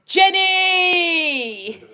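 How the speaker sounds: high, loud, drawn-out call; big stretched-out shout-out; excited name shout. Real, not acted. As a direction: holler the name and hold the last vowel.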